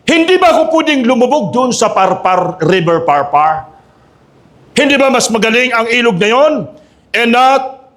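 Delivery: high-pitched indignant questioning; preacher acting out an outraged complaint; angry Naaman. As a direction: protest like an insulted general. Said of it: "Galit na galit si Naaman".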